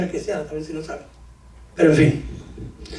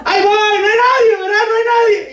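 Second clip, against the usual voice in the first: put-on scared child shouting; 10 semitones higher than usual. Preacher acting himself as a scared child, high laughing shouts. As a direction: shout the fear away like a boy in the dark. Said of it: "ya sacaba uno como el miedo".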